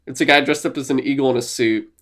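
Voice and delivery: ordinary delivery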